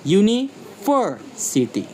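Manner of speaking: "University" slowly, high main stress on "ver". Shown as a demonstration. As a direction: split "University", secondary stress on "U", primary on "ver". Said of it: "Primary stress on ver and lighter secondary stress".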